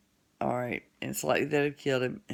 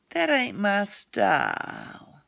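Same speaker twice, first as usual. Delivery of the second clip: haughty Casey; 4 semitones higher, slower than usual. Acted. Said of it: "said Casey".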